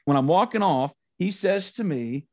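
ordinary talk, nothing marked

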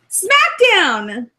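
long drawn-out mock announcer call; show announcement. Host announcing the next show to listeners, drawn-out call. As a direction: call out the show name, stretched slowly.

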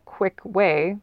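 ordinary talk

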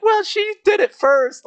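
high, loud, drawn-out whine; hammed-up indignation; defensive kid protest. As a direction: squeal it like a tattling child. Retold kid's defensive protest.

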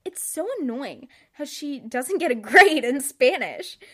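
normal talking voice

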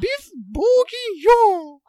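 loud, slow swooping cook; Julia Child. Acted. Host naming a dish as the TV cook, hammed-up swoops. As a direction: draw out the dish theatrically. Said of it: "the Julia Child story".

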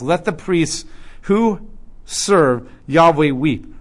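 loud, ringing reading of scripture; declaimed Bible reading. Acted. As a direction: proclaim the verses to the congregation. Reads Joel passage aloud.

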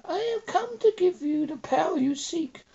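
high, eerie giant spider voice; female-pitched spider voice. Acted. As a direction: speak as a high, otherworldly spider. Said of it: "spider speaks with a female pitched voice".

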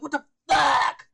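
slow, loud stunned shout; shocked shout. Retold or imitated. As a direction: blurt it out in disbelief. Reaction to seeing the movie.

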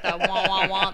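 muffled wordless wah-wah drone; Charlie Brown teacher. Retold or imitated. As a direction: imitate a cartoon adult's meaningless honking talk. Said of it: "the Charlie Brown teacher voice".

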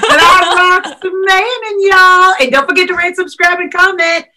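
high, laughing Texas twang; hammed-up Southern accent. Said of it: "we both can do this accent".

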